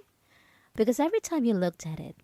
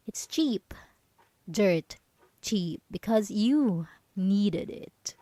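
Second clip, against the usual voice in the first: ordinary talk, nothing marked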